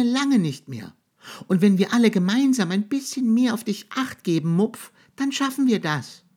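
high, loud, eager mouse voice; rally him like a tiny mouse; mouse encouraging. A narrator's reading. Mouse promises the monster everyone will help, high and loud.

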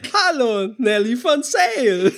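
high, slow teleshopping host voice; Nelly von Sale. Retold or imitated. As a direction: gush like an over-the-top TV shopping host. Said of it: "so gut aus Switch".

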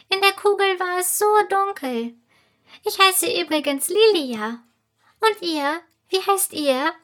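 high, tiny piping voice; a little fairy calling out, high and small; squeaky fairy voice. A narrator's reading. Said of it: "die piepsige Stimme".

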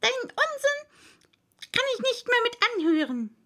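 high, indignant mouse voice; high-pitched fed-up complaint; annoyed mouse. A narrator's reading. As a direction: protest crossly in a very high voice. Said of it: "sehr ärgerlich".

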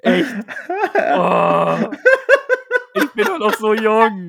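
laughing fake outrage; mock indignant. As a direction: play offended about your age. Mock outrage at being old.

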